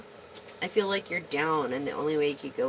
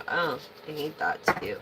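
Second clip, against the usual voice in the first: ordinary talk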